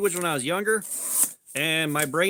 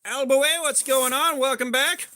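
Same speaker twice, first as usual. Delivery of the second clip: upbeat shout-out; 5 semitones higher than usual. Real, not acted. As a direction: hail the chatter like a streamer. Greeting a chat viewer by name.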